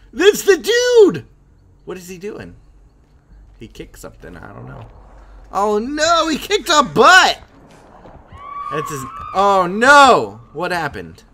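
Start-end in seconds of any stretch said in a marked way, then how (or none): 0.1-1.3 high, loud, drawn-out shriek; alarmed scream. Real, not acted. Player spotting the killer, alarmed scream. 5.5-10.4 high, loud cries of alarm; alarmed yelling. Real, not acted. Player watching the killer act, alarmed yelling.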